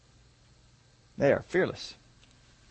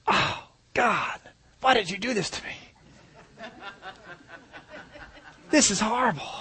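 higher, hammed-up wail to God with a laugh; mock lament; 8 semitones higher, 5 dB quieter than usual. Preacher mocking a congregant's ungrateful whine, higher and hammed-up. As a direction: play a whiny believer complaining, half laughing. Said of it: "How many of you saying".

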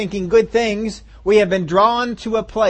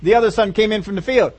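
ordinary talk, nothing marked

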